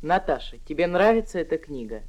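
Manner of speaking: usual speaking voice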